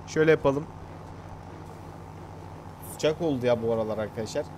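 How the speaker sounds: usual speaking voice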